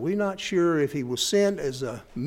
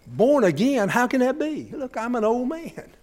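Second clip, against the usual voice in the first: higher, puzzled disbelief; incredulous; 6 semitones higher than usual. Retold or imitated. Old Nicodemus doubting Jesus, higher and incredulous. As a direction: question it, higher, baffled. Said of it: "Nicodemus reacted".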